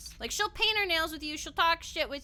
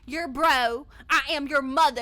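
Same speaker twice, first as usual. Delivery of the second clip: put-on thick Southern accent; 8 dB louder than usual. Daughter mimicking mom rejecting "bro", thick Southern accent. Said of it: "very thick southern accent".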